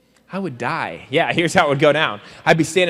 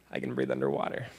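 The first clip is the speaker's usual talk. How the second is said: breathless whisper, much lower and softer; whispered last words; 12 dB quieter than usual. Pretend. The hero at the pool bottom, whispering. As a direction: whisper it as if drowning.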